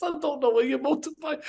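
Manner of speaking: very high, squeaky protest through held-in laughter; retold high-pitched, giggly denial; stifling laughter. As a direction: squeal it while trying not to laugh. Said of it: "I'm trying not to laugh".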